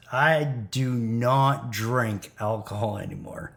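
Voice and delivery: ordinary delivery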